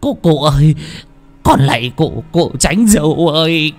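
grovel for your life; a panicked man begging the ghost beside him; frantic begging. Acted. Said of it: "càng rối rít van xin".

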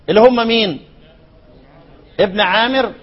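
ordinary delivery

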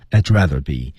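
I'd run smoothly into rather be; light linking. Shown as a demonstration. Teacher breaking down the phrase for learners, I'd linked to rather. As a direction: link I'd onto "rather" in one phrase. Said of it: "带了一点小的连读".

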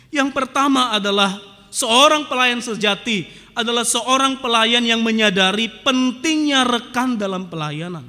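loud, driving sermon delivery; forceful preaching. Acted. Pastor hammering his point to the congregation, loud. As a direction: preach it out to the whole church.

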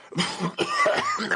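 rough cough cutting into the line; coughing fit. Acted. Old clerk coughs while complaining of his aches. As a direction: cough hard as if ill.

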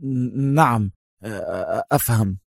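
stammered, halting assent; cowed, hesitant murmur; nervous mumble. A narrator's reading. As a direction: stutter it out, swallowing hard. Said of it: "ازدرد الرجل لعابه في صعوبة مغمغما".

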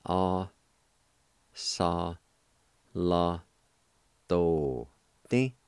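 slow, syllable by syllable, each one set apart. Shown as a demonstration. Teacher sounding out the word for students, syllable by syllable. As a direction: break it into slow separate syllables for the learner. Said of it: "is pronounced like this".

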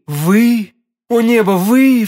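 high, loud burst of disbelief; astonished cry. A narrator's reading. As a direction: cry out in amazement at who it is. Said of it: "вскричала королева".

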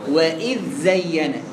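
وإذ زين with a distinct tongue-out dhal before the zay; clear unmerged dhal. Shown as a demonstration. Teacher showing students the izhar reading, slow clear dhal. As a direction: slowly separate the ذ of وإذ from زين. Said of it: "أعمل إيه؟ وإذ،".

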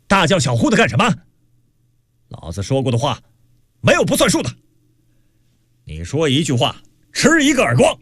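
gruff character's scolding, jumping high on the threats; rough angry bellow. A narrator's reading. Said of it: "玉光标在门外粗声喝道".

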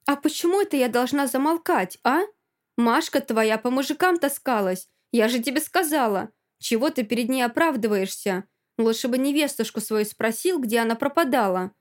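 cheeky, scandal-stirring challenge; brazen taunting. Acted. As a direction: needle them with accusations. Friend taunts back in a quarrel.

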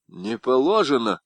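usual speaking voice

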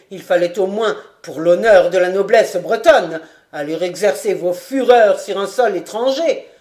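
loud, hammering denunciation; vehement. A narrator's reading. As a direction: thunder the accusations. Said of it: "Il continua avec véhémence". (Daumont continue d'accuser le baron avec véhémence)

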